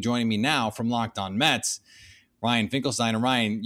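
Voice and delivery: usual speaking voice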